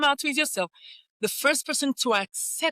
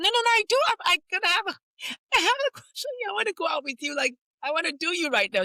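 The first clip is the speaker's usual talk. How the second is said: pleading with a crush, high and breathless; eager protest; 8 semitones higher, faster than usual. Retold or imitated. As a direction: insist desperately you really like her.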